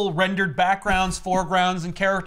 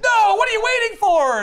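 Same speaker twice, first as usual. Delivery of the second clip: high, loud furious demand; mock yell; 7 dB louder than usual. Arliss yelling at Yith why she waits, high and loud. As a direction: shout it at her in disbelief. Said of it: "Arliss yells at Yith".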